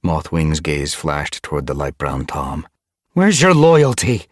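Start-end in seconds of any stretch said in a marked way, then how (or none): 3.1-4.3 loud, high, angry challenge; snapped. A narrator's reading. Mothwing rounds on disloyal Lizardtail, snapping. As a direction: snap it accusingly. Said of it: "she snapped".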